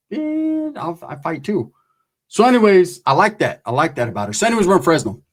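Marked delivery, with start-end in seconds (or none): 0.1-1.7 ex-wife unbothered. Retold or imitated. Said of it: "My ex-wife was like".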